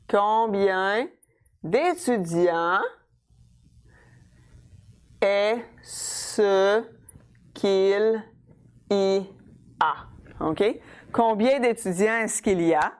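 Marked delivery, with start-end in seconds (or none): 0.1-10.1 very slow, long pauses between chunks; teacher spelling out a sentence, word by word; piece by piece. Shown as a demonstration. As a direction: build the question one piece at a time. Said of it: "So I'm gonna start with my interrogative word".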